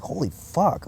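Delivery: high-pitched exclamation of awe; retold amazement. Speaker retelling an angel vision to viewers, amazed. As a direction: replay a stunned curse.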